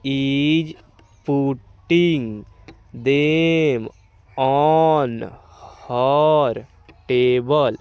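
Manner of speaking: each word stretched long, with a pause after; drawn-out teacher's model reading. Shown as a demonstration. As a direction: read it out slowly for beginners. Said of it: "इसको हम एक साथ अब पढ़".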